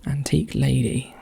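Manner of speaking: soft whisper of the clue words; whispering to self. Real, not acted. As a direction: say it under your breath in a whisper.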